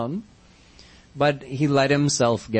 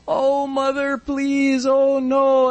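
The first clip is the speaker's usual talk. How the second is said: high-pitched, acted tearful begging; crying plea; 11 semitones higher, slower than usual. Frightened child begs mother not to punish him, crying plea. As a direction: plead up high like a bawling little boy. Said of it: "Kanha starts crying, bawling his eyes out".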